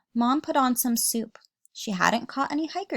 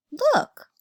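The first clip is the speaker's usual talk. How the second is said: very high, louder squeak; squeaky look; 7 dB louder than usual. Acted. George shows his small feet, squeaky. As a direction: pipe up like a tiny kid.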